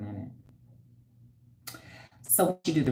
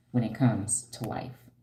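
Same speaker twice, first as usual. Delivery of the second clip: usual speaking voice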